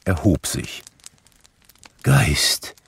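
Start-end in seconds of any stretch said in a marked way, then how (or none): 2.0-2.8 whispered, humble address. A narrator's reading. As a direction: breathe the word meekly. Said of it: "sagte Scrooge demütig".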